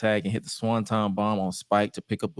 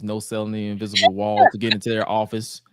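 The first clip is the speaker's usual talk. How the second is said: ordinary talk, nothing marked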